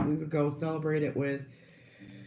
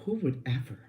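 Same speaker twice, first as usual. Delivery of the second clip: whispered mock innocence; sarcastic whisper; 3 dB quieter than usual. Sarcastic aside.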